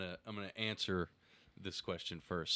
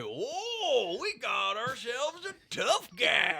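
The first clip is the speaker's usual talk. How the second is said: high, loud, slow southern taunt; southern accent; 13 semitones higher, 5 dB louder, much slower than usual. Retold or imitated. Comic answering a tough-guy heckler, southern accent. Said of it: "I got real down south on him".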